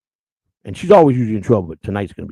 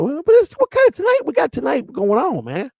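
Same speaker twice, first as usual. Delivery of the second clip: much higher, squeaky what-kind-of-tonight; squeaky disbelief. Real, not acted. Host reacting to Kahuna's comment, squeaky high disbelief. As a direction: pitch way up in mock shock. Mock-confused reaction to partner.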